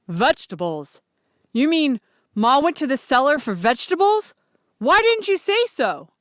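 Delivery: dawning panic; horrified father. Acted. Pa realizing Ma is locked in the cellar, alarmed. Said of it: "Pa looked horrified".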